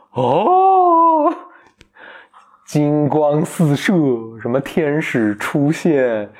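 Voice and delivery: slow, awed storytelling; hammed-up grand reveal, slowed right down; mock epic narration. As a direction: paint the miracle slowly.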